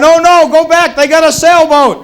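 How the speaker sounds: high, loud put-on Russian accent; panicked Russian sailor, Russian accent hammed up; mock Russian accent. Comedian mocks Russians at sea with a Russian accent. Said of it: "they'd be going".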